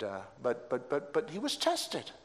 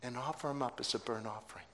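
hushed; 6 semitones lower, 12 dB quieter, much faster than usual. Real, not acted. Preacher repeats command to parents.

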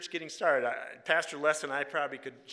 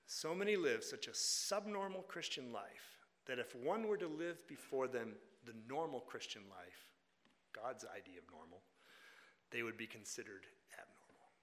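softer, lower, at an ordinary pace; quiet repeat; 4 semitones lower, 10 dB quieter than usual. Shown as a demonstration. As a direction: repeat it quietly, letting it sink in. Repeats the dictated quote more quietly.

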